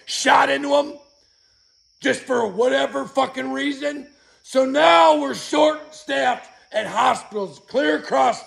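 furious yelling. Real, not acted. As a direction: yell it out in real fury. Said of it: "I just had to come out here and fucking yell".

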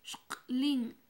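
Shklin half whispered, sh kl then in; Mam word, breathy demo. Shown as a demonstration. Teacher models the Mam word for light blue, half whispered. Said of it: "Pasamos al siguiente".

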